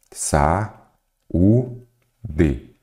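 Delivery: "sa, u, d" with a pause after each syllable; sílaba por sílaba. Shown as a demonstration. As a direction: separate "sa, u, d", lifting the u. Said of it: "vou separar em sílabas". ("sa, u, d" separado em sílabas, com pausas)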